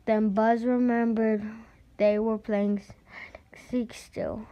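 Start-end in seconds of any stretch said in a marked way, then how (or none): none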